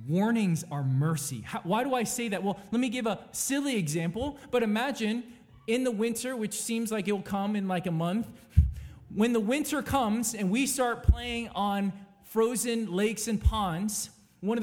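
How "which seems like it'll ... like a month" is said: normal talking voice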